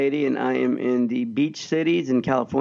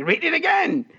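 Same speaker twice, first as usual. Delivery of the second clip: much higher mock stern teacher; 13 semitones higher than usual. Mrs Webb ordering the boy to reread, in a much higher mock stern voice. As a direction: bark it the way the scolding teacher did. Teacher scolding him before the class.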